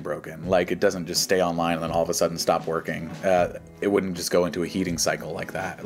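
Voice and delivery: ordinary talk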